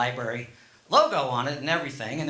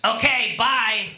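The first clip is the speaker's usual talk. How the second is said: louder, higher imitated wife; wife's brush-off; 5 semitones higher, 5 dB louder, slower than usual. Wife answering husband's bedtime apology, loud imitated brush-off. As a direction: cut in loud and pitched up, mock dismissive. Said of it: "And she goes".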